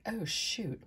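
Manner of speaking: ordinary talk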